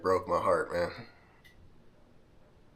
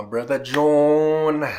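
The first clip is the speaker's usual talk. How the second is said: higher, louder, slow announcement of his brother; drawn-out loud call; 8 semitones higher, 12 dB louder, much slower than usual. Real, not acted. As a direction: call the name out big and slow. Announcing next friend loudly.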